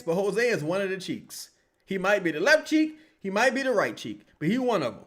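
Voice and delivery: ordinary talk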